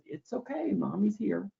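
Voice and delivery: put-on high, hushed soothing of a fussing baby; soothing mom. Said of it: "and mom says".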